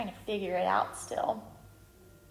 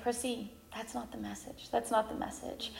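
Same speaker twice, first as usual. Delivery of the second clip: hushed inner voice; 11 dB quieter than usual. Retold or imitated. Inner voice of God.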